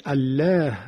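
الله with a thin, light L and fronted 'eh' vowel; wrong thin lam. Shown as a demonstration. Teacher voicing a learner's mistaken guess, thin lam. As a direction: say الله flat-tongued as 'al-leh'. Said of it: "باید غلیظ بشه".

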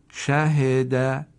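slow, drawn-out blended word; slow blended reading. Shown as a demonstration. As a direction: stretch the word slowly as one unit. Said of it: "دقت بفرمایید".

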